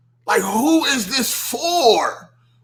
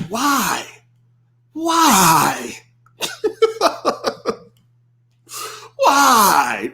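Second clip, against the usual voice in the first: mock wail; much slower than usual. Mock despair at tweets.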